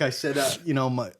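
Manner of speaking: usual speaking voice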